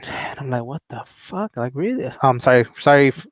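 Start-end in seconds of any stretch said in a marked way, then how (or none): 0.7-2.1 quiet, incredulous aside; hushed retold disbelief; muted disbelief. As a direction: swear under your breath in disbelief. Disbelief at officer's demand.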